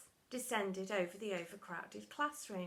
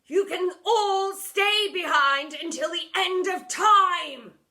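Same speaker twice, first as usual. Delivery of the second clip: loud, slow, higher seething threat; 9 semitones higher, 13 dB louder, slower than usual. A narrator's reading. Deputy threatening from the doorway, loud and slow. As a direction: spit out the threat in fury. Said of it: "seethed Seethes".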